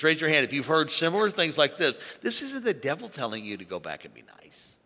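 ordinary delivery

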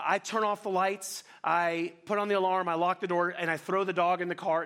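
ordinary talk

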